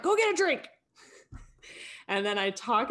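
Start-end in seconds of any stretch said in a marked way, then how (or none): 0.0-0.7 high, loud coach's order; frustrated yell. Retold or imitated. Coach retelling sending frustrated team away, high yell. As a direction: call it out to the whole team, exasperated. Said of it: "then I really get frustrated".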